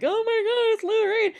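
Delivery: high-pitched, contained fan squeal; starstruck squeal. Retold or imitated. Retelling a [name] sighting at brunch, starstruck squeal. Starstruck reaction to seeing celebrity.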